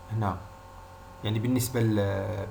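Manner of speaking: ordinary talk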